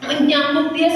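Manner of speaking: ordinary talk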